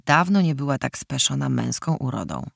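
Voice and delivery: usual speaking voice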